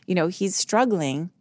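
ordinary talk, nothing marked

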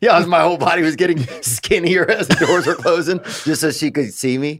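real laughter through a funny story; laughing. Real, not acted.

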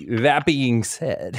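very slow, stretched words; drawn-out pivot. Real, not acted. Co-host pivots after the joke, drawn out. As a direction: drag out each word.

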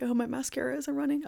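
teary joke. Real, not acted. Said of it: "I'm straight up crying".

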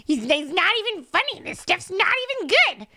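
mock-indignant rant climbing in pitch; building scoff. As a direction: escalate the put-down until it tips over.

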